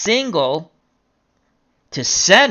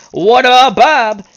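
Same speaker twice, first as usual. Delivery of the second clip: loud, raised greeting to the batter; hearty call-out; 8 semitones higher, 8 dB louder, slower than usual. Pretend. Announcer hails Bob with bases juiced, loud playful call. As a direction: holler a playful hail.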